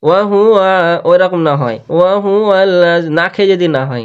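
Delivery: ordinary talk, nothing marked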